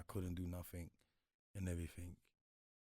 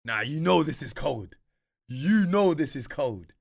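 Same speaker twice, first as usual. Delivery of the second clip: loud, slow, pleased with himself; hyped-up self-praise; 6 semitones higher, 14 dB louder, slower than usual. Retold or imitated. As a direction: talk yourself up, loud and slow. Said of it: "I'll be like".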